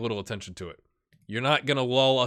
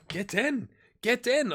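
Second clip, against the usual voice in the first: excited celebration; 10 semitones higher, slower than usual. Real, not acted. Gamer celebrating a goal, excited cheer. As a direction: pump the fist and cheer the goal.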